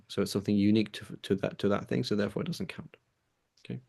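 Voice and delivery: drowsy, fading speech; sleepy and trailing off; nodding off. Real, not acted. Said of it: "I'm literally falling asleep while I'm talking".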